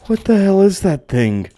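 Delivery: loud, high cry of disbelief; shocked shout. Real, not acted. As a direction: yell it at the creature you just saw. Second monster sighting.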